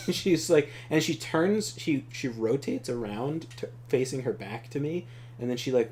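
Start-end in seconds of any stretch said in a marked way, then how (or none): none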